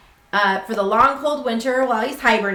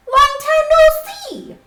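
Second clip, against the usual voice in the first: high, louder friendly hare voice; cheerful hare; 7 dB louder, slower than usual. Acted. Hare greeting the mouse, high cheery voice. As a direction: greet an old friend brightly. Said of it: "says Hare".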